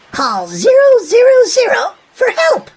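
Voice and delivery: a touch of an official voice; note voice. Acted. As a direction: read the note like an instruction. Reading the note in the chest.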